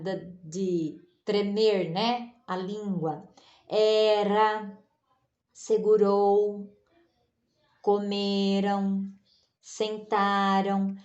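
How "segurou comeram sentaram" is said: segurou, comeram, sentaram said slowly with a light tapped r; slow each word, keeping the r soft; soft R demo. Shown as a demonstration. Teacher to class, slowly showing the soft R. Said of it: "o R ali é brando".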